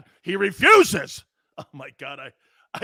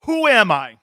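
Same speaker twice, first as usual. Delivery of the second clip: a mystery impression asked loudly; calling out the guess line before the big reveal; unnamed impression, loud take; 7 dB louder than usual. Teasing viewers with a loud mystery impression. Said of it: "can I do an impression".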